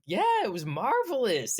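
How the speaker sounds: high-pitched imitated delight; cute caller. Imitating a charmed woman phoning in, delighted. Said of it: "it was really cute 'cause she was like".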